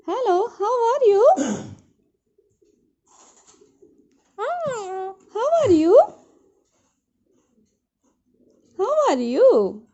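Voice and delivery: high, loud, very slow how are you; sing-song question. Shown as a demonstration. Mother teaching the baby to ask how are you, high and slow. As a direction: stretch each word to teach the baby. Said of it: "teaching baby to ask".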